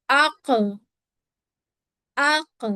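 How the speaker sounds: आक with the final क bounced, echoing back; qalqala echo. Shown as a demonstration. Teacher demonstrating the qalqala bounce to students. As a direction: let the क in आक rebound with a small echo. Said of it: "देखिए move हो रही है".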